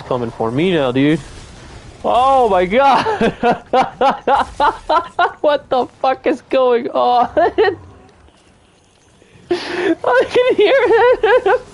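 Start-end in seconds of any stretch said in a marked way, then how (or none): 2.0-7.4 high, slow, laughing shriek; excited laughing scream. Real, not acted. Monster chasing in game. 9.5-11.6 very high, slow, scared squeal; frightened squeal. Real, not acted. Hearing monster approach.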